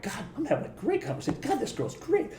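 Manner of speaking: hushed, lifted voice for a private thought; retold inner thought. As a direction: think aloud softly, as if smitten. Retold private thoughts while driving.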